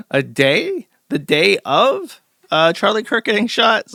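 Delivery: high, laughing voice breaking through the words. Real, not acted. Host laughing in disbelief at the tweet's timing, laughing. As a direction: giggle it out, barely holding it together.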